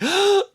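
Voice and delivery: sharp high mock gasp, loud; excited gasp. Host acting Max's thrilled gasp at Jenna, high and loud. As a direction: gasp in delight like a starstruck kid.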